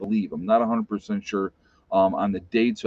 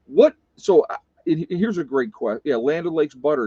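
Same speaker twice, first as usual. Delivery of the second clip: normal talking voice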